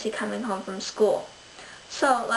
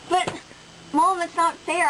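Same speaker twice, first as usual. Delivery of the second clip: nasal whine; 7 semitones higher, slower than usual. Acted. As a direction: whine it like a wronged kid. Child protests mother's decision.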